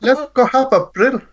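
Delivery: hammed-up South African accent, groping for the word; bad South African accent, joking. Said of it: "my South African accent".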